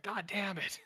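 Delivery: high, exasperated God damn it; frustrated groan. Retold or imitated. Shane listening to the stakeout, high exasperated curse. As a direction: throw up your hands at the missed bust. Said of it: "and he's like".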